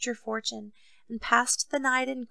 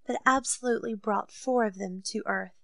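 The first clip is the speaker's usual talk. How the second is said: plain reading voice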